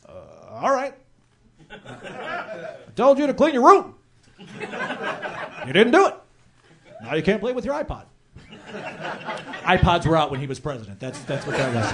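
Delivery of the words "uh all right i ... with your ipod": Obama as dad. Retold or imitated. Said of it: "He's like".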